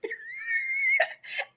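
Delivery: very high shrieking laugh. Real, not acted. Host shrieking with laughter at the insult someone made. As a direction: let out a wild squealing laugh.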